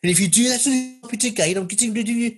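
high, loud Brummie telling-off; Brummie Shakespeare. Shown as a demonstration. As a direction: scold in a comic Brummie accent. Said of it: "in a Brummie accent".

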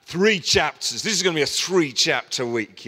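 cocky and wired on coffee; caffeinated brag; overexcited boast. Retold or imitated. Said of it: "I'd had an espresso".

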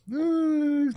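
high mock wail; choro imitado. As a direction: imitate her bursting into tears, high-pitched. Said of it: "começou a chorar". (choro fingido, bem agudo)

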